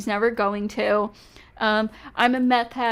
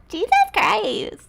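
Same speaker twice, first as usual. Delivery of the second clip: mocking echo; 9 semitones higher, slower than usual. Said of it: "she's making fun of me".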